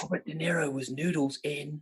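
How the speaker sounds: hushed, pretend secret whisper; softly feed the answer as if into an ear; mock whisper. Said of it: "whispering into my ear".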